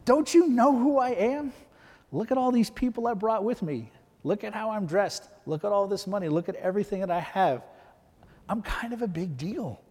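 indignant outrage. Retold or imitated. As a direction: puff up and act insulted, pitched well above your own voice. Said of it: "is immediately angered".

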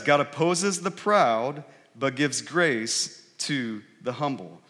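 slow, weighty reading of the verse; slow scripture reading. Shown as a demonstration. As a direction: lay each word down slowly. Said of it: "Notice what it says".

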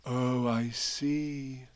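very drawn-out, hushed reply; slow, soft realisation. A narrator's reading. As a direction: let each word stretch out softly. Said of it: "said Pooh".

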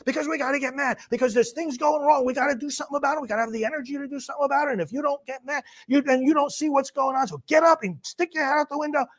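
high-pitched, agitated movie rant; worked-up tirade. Acted. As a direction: press the crowd to get mad. Acting the film's angry tirade.

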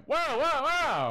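high, loud imitation of a panicked host; imitating someone frantically halting a swearer; alarmed interruption. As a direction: cut in with a mock-alarmed high cry. Retold host alarmed at cursing.

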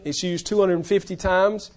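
usual speaking voice

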